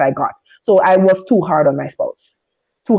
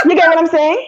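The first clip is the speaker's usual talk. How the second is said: laughing it off, voice jumping higher; embarrassed laugh; 11 semitones higher than usual. Real, not acted. Speaker laughing at her own risqué line to the group, embarrassed laughter. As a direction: giggle and brush past the cheeky line.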